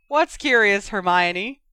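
loud, high mock question; yelling. A narrator's reading. George playing along with the letter, yelling. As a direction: yell it back in teasing reply. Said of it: "George yelled".

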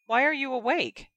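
sharp, high questioning; surprised. Acted. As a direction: demand it in surprise. Ginny questions Ron sharply.

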